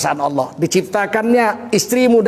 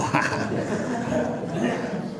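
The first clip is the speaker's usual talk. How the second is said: breathy laugh; 4 semitones higher, 10 dB quieter than usual. Real, not acted. Laugh at the tale.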